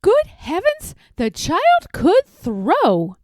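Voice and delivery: high, astonished exclamation; amazed. A narrator's reading. Squirrel narrator marvelling at the child's throw. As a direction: gasp at the throw.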